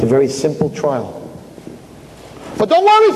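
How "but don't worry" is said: much higher, louder angel voice; sweet high reassurance from above; defending angel. Acted. Angel Michal comforts Chaim in a very high voice. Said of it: "says Michal".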